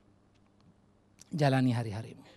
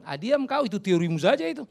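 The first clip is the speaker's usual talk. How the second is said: dismissive retort; 7 semitones higher, 6 dB louder than usual. Acted. Preacher retorts to mocked congregant.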